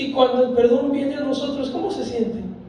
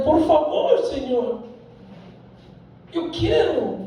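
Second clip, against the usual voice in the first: higher, urgent begging voice; pleading; 4 semitones higher, slower than usual. Shown as a demonstration. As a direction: beg for it with your whole heart. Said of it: "El rogar es". (súplica desesperada, más aguda)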